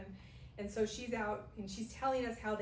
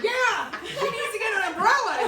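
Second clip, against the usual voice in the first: high, loud laughing agreement; expected agreement; 12 semitones higher, 15 dB louder than usual. Retold or imitated. Listeners the storyteller hoped would side with her, high loud laughing agreement. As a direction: cheer her on as if obvious. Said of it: "I'm waiting for everybody to go".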